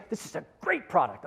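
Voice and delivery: forced whisper with emphasis on "great". Shown as a demonstration. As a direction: punch the word "great" in an intense stage whisper. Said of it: "It's a forced whisper, emphasis on the word great".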